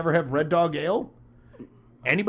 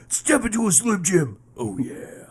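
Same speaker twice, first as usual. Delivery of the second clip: louder wrestler growl on Step into a Slim Jim; Randy Savage impression; 3 semitones higher, 4 dB louder than usual. Host echoes the Slim Jim slogan as Randy Savage. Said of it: "Randy Savage".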